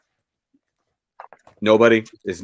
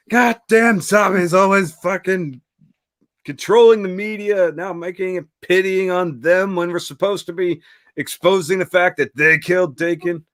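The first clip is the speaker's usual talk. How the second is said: pissed-off rant; 7 semitones higher than usual. Retold or imitated. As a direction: imitate a man raving, out of his mind. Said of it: "He's so pissed".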